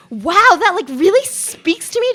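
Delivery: high, loud, breathless fangirl gasp; hammed-up swooning delight; mock gushing. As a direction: gush like an overwhelmed fan. Mock gushing fan reaction.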